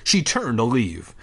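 ordinary delivery, nothing marked

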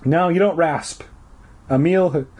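plain reading voice

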